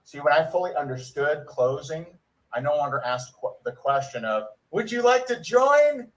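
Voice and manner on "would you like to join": high, hammed-up sales ask; put-on high recruiter voice; eager recruiter. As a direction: pitch it up like an over-keen recruiter. Voices the pitch he stopped using.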